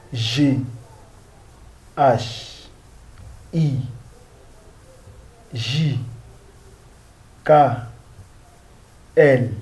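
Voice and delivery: each letter said slowly and apart; French alphabet recital. Shown as a demonstration. Teacher reciting the French alphabet letter by letter. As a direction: call out the French letters one by one for the class. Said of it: "we pronounce this as A".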